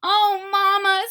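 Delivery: high, loud, tearful little witch; tearful Maggie. Acted. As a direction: cry it out to mama. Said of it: "said Maggie".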